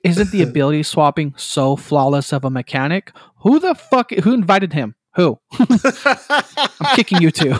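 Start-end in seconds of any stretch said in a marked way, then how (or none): 3.4-7.5 mock outrage. Mock teammate kicking player.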